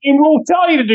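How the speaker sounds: high, frantic game piece voice; mock panic. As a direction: shriek it like a terrified board piece.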